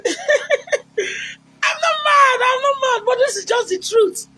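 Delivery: squealed very high, half laughing, half crying; a man giggling and wailing his innocence in a very high voice; overexcited suitor, squeaky and giggly; laughing lovestruck man. Retold or imitated.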